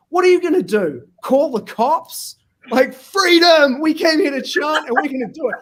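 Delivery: loud, high furious yelling; angry shout. Retold or imitated. At the protest, yelling back at objectors, furious shout. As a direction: shout them down in a rage. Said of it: "I was so angry by that stage".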